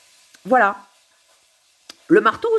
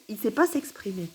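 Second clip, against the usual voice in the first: ordinary talk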